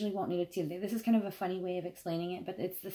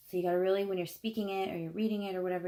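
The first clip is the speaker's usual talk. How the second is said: usual speaking voice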